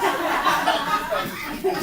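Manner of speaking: loud burst of laughter; big laugh. Real, not acted. Laughs at teacher's reply.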